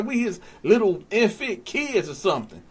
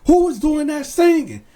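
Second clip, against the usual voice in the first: raised, high, insistent officer's yell; imitating angry guard; 4 semitones higher, 3 dB louder than usual. Guard yelling at inmates to stop singing, acted shout. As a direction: bark orders like an exasperated guard.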